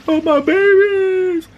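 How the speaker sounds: shrieking high, loud, slow wail; hammed-up grieving dragon mother. Mocking Daenerys crying over her chained dragons, shrieking. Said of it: "She's like".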